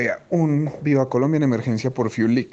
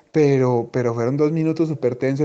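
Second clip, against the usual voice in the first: ordinary talk, nothing marked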